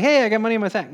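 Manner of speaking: lightly mocking glee; mock excited. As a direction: play the delighted finder. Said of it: "You're like".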